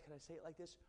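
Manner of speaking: normal talking voice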